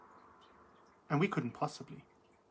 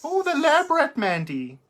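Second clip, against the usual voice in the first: delighted recognition; 9 semitones higher, 6 dB louder than usual. Real, not acted.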